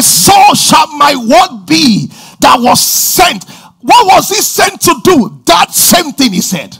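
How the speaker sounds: loud, climbing sermon shout; preaching at full force. Acted. Preacher declaring the word to the church, loud full-force preaching. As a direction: thunder it out from the pulpit. Expounding Isaiah with fervor.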